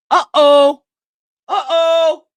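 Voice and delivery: startled alarm at the play; loud uh-oh. Real, not acted. Big play on screen.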